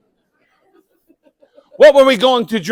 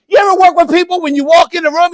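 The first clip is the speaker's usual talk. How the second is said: ordinary talk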